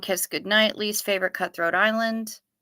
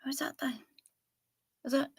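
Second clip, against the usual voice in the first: whisper; 5 semitones higher, 11 dB quieter than usual. Pretend. Hushed aside while calling.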